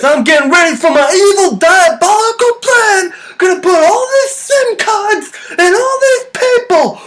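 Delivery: high, slow villain with a lisp; Samuel L Jackson lisp. Retold or imitated. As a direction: ham up a lisping evil villain. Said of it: "it kinda sounds something like this".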